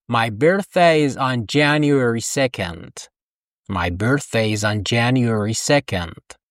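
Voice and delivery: slow careful English sentence; teacher modelling slowly; slow model sentence. Shown as a demonstration. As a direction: say it slowly for repetition. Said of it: "با من تکرار کن".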